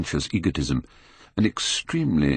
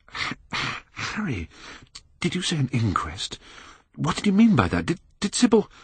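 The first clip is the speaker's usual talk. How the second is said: higher, breathless stammering in horror; stifled stammer; 12 semitones higher than usual. Acted. Dorian stammers to Harry, stifled and horrified. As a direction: choke the words out, dazed. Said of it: "he stammered in a stifled voice".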